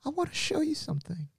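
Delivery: put-on very high, soft, coaxing old lady; grandma luring him to see the dolls, very high; eerie grandma. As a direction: tempt him like a storybook witch. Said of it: "she's like".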